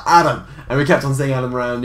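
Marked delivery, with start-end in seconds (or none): 0.1-0.5 loud shout of a name; calling out. Retold or imitated. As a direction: yell the name across the room. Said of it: "He was like".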